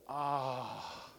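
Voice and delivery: mock sigh of total relief; relieved sigh. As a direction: breathe out a relieved ah. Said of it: "Total relief".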